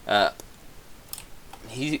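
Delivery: ordinary delivery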